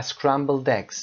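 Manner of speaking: scrambled eggs drawn out and linked; scrambled eggs as one word; slow linked demo. Shown as a demonstration. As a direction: slowly link scrambled into eggs. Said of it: "scrambled eggs به این شکل".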